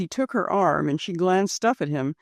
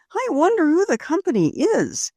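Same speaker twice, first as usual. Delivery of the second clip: Rose thinking; 10 semitones higher than usual. Acted. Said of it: "she thought".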